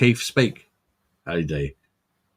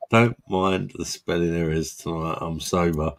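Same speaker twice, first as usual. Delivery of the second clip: lightly put-on posh voice, low and soft; a hint of a pipe-smoking gentleman, kept quiet; monocle-and-pipe voice; 4 semitones lower, 6 dB quieter than usual. Tipsy friend claiming to be sober, lightly posh voice. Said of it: "You need a monocle and a pipe with that voice".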